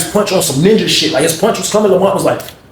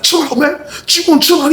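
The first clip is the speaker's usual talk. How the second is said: high-pitched mock command; acted insistent order; 12 semitones higher than usual. [name] orders Sean to calm.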